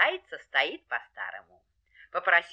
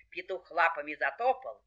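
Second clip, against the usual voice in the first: ordinary delivery, nothing marked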